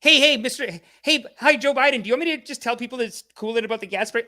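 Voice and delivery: hammed-up eager-to-please host; eager mock suck-up. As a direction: grovel like a teacher's pet. Acts Colbert begging Biden.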